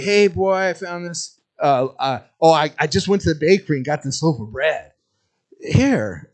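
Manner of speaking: a dad tricking his son, playing innocent; sly dad voice. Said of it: "he's like".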